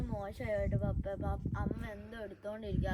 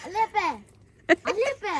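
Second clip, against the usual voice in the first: louder, higher call to dad; 6 semitones higher, 9 dB louder than usual. Real, not acted. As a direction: call out to get his attention. Calling out to dad repeatedly.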